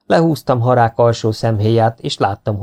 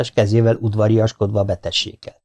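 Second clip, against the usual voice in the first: ordinary delivery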